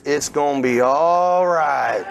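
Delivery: slow drawl with a long "all"; Southern Mississippi accent. Shown as a demonstration. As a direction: draw it out like a Mississippi motto. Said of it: "in your best Southern Mississippi accent".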